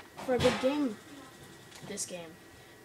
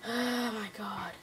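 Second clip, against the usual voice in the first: exasperated whisper; 3 semitones lower, 7 dB quieter, slower than usual. Real, not acted. Exasperated at brother.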